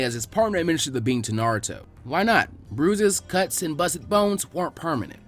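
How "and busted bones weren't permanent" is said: ordinary delivery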